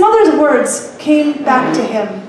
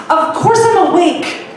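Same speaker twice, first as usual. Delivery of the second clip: peevish; 7 semitones higher, 3 dB louder than usual. Acted. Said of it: "Remy said peevishly".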